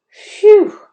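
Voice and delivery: very high, loud phew of relief; relieved gasp. A narrator's reading. Ralph gasping after the dog leaves, relieved. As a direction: let out a big gasp of relief. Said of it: "gasped Ralph".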